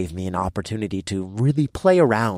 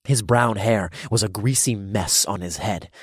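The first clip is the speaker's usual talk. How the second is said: ordinary delivery, nothing marked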